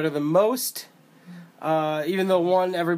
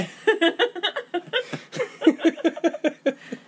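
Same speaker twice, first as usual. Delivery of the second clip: embarrassed giggle; 13 semitones higher than usual. Real, not acted. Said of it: "This is what I mean by embarrassing".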